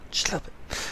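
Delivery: whispered aside. Real, not acted. Muttered aside at the game developers.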